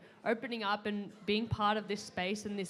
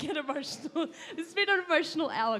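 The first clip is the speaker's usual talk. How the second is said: quick, higher, laughing through the words; emotional laugh while thanking; 7 semitones higher, 3 dB louder, faster than usual. Real, not acted. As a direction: let a nervous laugh break in. Admits getting emotional on stage.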